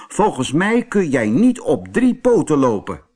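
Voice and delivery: high, loud challenge from the bull; challenging bull. Acted. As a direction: taunt the elephant proudly.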